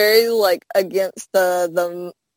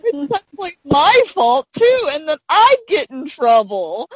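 mock Hal; 6 semitones higher, 4 dB louder than usual. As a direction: whine it like a cornered husband. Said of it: "He goes".

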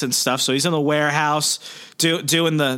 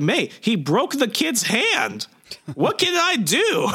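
much higher, slow, whiny mock despair; distraught Ben; 11 semitones higher, slower than usual. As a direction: overplay a helpless uncle, drawn out. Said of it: "He's like".

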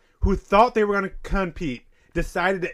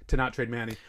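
ordinary delivery

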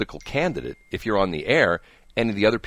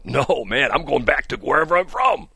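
lightly voiced, higher mock reply; a hint of a fed-up worker; mock migrant; 5 semitones higher, 3 dB louder than usual. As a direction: toss it off as an imagined migrant. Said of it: "Some will go".

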